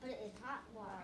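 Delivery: soft, low, tentative answer; small, hushed reply; too quiet. Real, not acted. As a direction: answer quietly, barely projecting. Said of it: "Say it louder".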